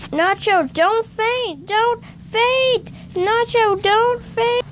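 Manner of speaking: high alarmed pleading; panicked plea to Nacho not to faint. Pretend. Friend collapsing during the climb.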